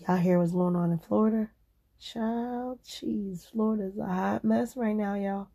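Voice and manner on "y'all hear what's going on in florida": ordinary delivery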